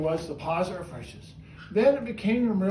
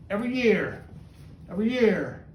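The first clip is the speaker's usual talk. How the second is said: normal talking voice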